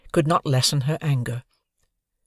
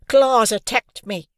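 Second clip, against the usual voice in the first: high, loud, slow whimpering complaint; sobbing accusation; 8 semitones higher, 5 dB louder, slower than usual. Acted. Pickle sobbing to the teacher, bloodied nose. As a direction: whine it through sobs.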